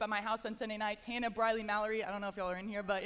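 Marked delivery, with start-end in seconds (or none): none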